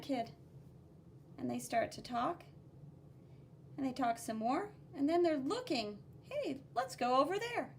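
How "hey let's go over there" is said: put-on higher, childlike call to a friend; kid's voice. Blue kid inviting red kid, higher childlike voice. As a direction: voice the excited kid.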